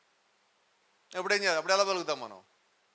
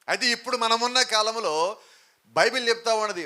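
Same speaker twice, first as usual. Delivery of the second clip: higher, louder sermon climax; fervent preaching to the church, voice raised; full-force preaching; 5 semitones higher, 7 dB louder than usual. Acted. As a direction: drive home the faith call to the congregation.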